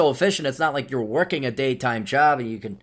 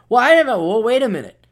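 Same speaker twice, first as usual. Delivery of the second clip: higher, louder surprised realization; sudden realization; 7 semitones higher, 5 dB louder than usual. Retold or imitated. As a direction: catch yourself mid-thought.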